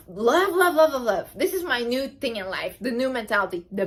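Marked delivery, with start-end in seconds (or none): 0.1-1.3 gushing. Real, not acted. Gushing over new mentality.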